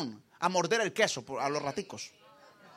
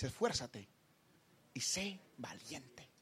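slow, whispered verse; hushed verse reading; 6 semitones lower, 8 dB quieter, much slower than usual. Acted. Pastor whispering the verse to the congregation. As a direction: breathe the verse out slowly.

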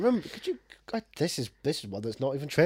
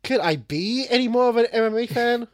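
high-pitched sarcastic emphasis on BE; hammed-up, higher incredulous quip; mock sitcom catchphrase; 8 semitones higher, 3 dB louder than usual. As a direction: exaggerate it like a sitcom wisecrack. Mock sitcom catchphrase interrupting the retelling.

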